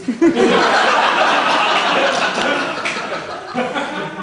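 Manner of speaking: long high wheezing laugh that rises; helpless loud laugh; breathless laugh. Real, not acted. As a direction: crack up completely. Laughs at album title.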